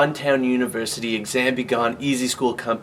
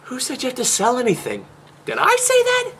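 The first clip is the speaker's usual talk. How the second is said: squeaky mock denial; 10 semitones higher than usual.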